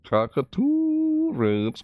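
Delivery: very high, slow squeak; squeaky drawl. Acted. Reading item name silly.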